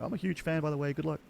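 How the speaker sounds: bashful fan greeting a pro player; nervous fanboy. Retold or imitated. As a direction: play the shy fan in the lobby. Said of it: "I'm like".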